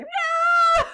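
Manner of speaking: high anguished shout. Retold or imitated. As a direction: cry out no in dismay. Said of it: "I was like".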